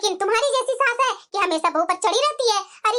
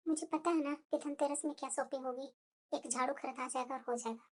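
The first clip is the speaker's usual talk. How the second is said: much softer, lower sarcastic aside; muttered aside; 11 semitones lower, 17 dB quieter than usual. Acted. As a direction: say it under your breath so mother-in-law can't hear. Bahu's sarcastic aside to herself.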